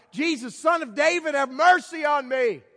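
a high cry carried far for mercy; shouting out. Retold or imitated. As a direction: raise the plea high and loud above the crowd. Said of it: "And he shouted out".

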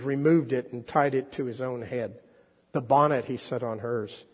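expressive storyteller read aloud; story reading. Acted. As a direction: draw the congregation into the tale. Preacher reads The Rag Man aloud.

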